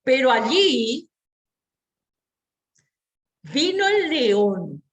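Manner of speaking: slow, loud dramatic telling; dramatic build. Shown as a demonstration. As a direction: build suspense as the lion comes in.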